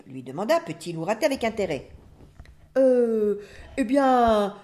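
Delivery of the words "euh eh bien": drawn-out, hesitant stalling; caught off guard. A narrator's reading. Parrot asked the word's meaning, caught off guard. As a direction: stall for an answer, filling with ums. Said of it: "pris au dépourvu".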